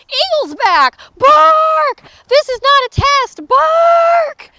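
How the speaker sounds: high, full-force shouted warnings; urgent, loud siren-like announcement; watchdog alarm. Acted. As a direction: bellow the alarm like a barking dog.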